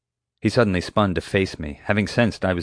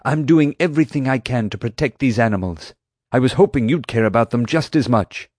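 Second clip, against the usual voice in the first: raised, higher and louder plea; exclaiming; 6 semitones higher, 4 dB louder than usual. Acted. Atmani pleading with Doc, raised and louder. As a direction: push it out in hurt protest. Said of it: "Atmani exclaimed".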